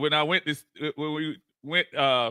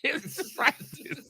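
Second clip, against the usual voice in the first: soft, slow, high echo of Iverson; half-laughing Iverson impression; lightly voiced Iverson repeat; Allen Iverson quote; 11 semitones higher, 9 dB quieter, much slower than usual. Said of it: "famous words of Allen Iverson".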